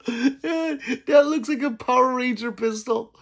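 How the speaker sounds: high, laughing through the words. Real, not acted. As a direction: crack up as you say it. Laughing at chat's joke.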